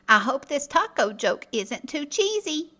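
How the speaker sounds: ordinary delivery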